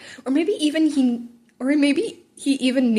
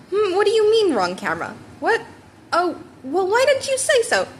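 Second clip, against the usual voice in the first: lightly voiced hero, higher and louder; a hint of a big hero fussing offscreen; lightly voiced All Might, puzzled; 9 semitones higher, 3 dB louder than usual.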